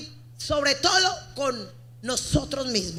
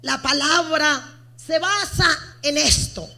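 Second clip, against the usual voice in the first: usual speaking voice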